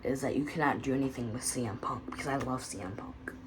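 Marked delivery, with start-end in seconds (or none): 2.1-3.3 hushed confession, barely voiced; whispered. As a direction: breathe it out as a secret. Whispered quote, unvoiced.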